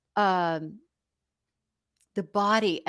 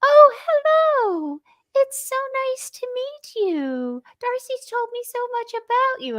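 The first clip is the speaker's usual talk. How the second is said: a hostess trilling hello in a much higher voice; mother's proper company voice; 13 semitones higher than usual. Retold or imitated. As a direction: gush politely like a proper mother meeting guests. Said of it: "she would say".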